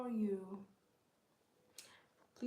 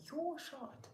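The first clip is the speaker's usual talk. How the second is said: sister insisting; 10 semitones higher, 3 dB louder than usual. Retold or imitated. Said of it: "She's like".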